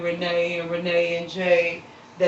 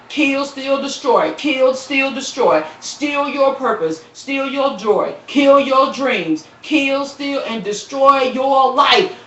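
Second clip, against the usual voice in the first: rising preacher's cadence, a bit higher; building preacher rhythm; preaching; 5 semitones higher, 4 dB louder, slower than usual. Acted. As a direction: hammer each word like a sermon. Preaching list against the enemy.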